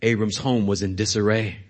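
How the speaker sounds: usual speaking voice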